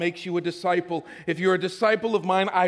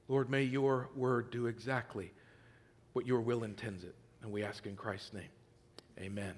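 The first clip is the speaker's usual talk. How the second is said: quiet, reverent prayer voice sinking softer to the amen; 5 semitones lower, 4 dB quieter than usual. Acted. Pastor praying over his congregation, hushed and reverent. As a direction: pray it low and hushed, closing with amen.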